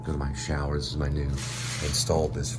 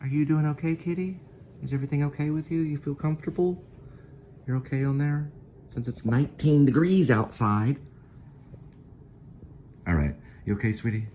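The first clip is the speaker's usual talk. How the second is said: talking to the cat; 12 semitones higher than usual. Real, not acted.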